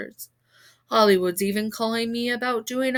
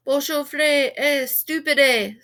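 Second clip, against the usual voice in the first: French reporter; 4 semitones higher, 6 dB louder, slower than usual. A narrator's reading. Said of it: "screamed a reporter wearing a beret".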